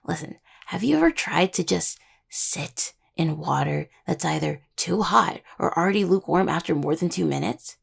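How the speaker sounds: normal talking voice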